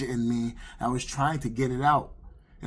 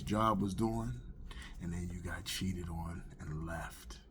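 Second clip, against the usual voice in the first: low, soft, gloating taunt; a hint of a devil, quietly needling with pauses; lightly voiced devil; 4 semitones lower, 6 dB quieter than usual. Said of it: "the devil was like".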